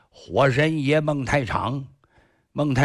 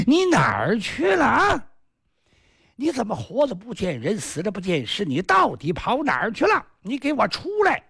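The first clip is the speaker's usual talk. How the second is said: high wailing shout; crying wife's wail; 8 semitones higher than usual. Acted. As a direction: cry out for him, high and desperate. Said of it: "孟香女儿天天哭啊". (高声哭喊的媳妇)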